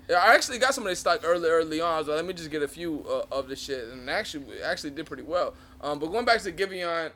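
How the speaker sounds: normal talking voice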